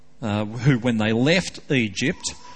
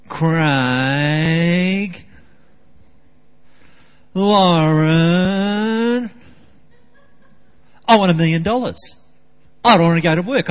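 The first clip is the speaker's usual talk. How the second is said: put-on higher, louder whiny child voice, dragging every want; mock whining kid; 6 semitones higher, 9 dB louder than usual. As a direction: ham up a child begging and whining. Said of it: "I sit right down next to 'em and I go".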